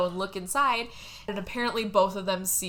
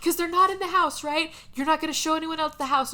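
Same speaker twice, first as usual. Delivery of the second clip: pitched-up mock explaining; lightly hammed-up pressing, higher voice; imitated insistence; 7 semitones higher than usual. Frank making George agree, high insistent mock reasoning. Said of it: "he's like".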